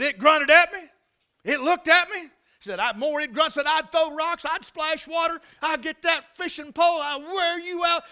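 high, loud, building account; excited retelling. Retold or imitated. Guide telling how he fought off the grizzly, excited. Retelling his fight with bear.

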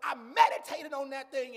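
loud, high whooping preacher cadence; preaching at full force. Acted. Pastor whooping to the congregation, loud and high.

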